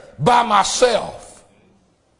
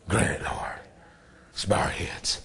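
breathy whispered amen; preacher whispering before leading prayer; 13 semitones lower, 11 dB quieter than usual. Real, not acted. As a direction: whisper it to the bowed congregation.

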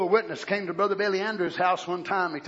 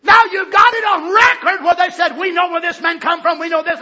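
preaching shout; 8 semitones higher, 12 dB louder than usual. Acted. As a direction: hammer each we know home. Preaching at full force.

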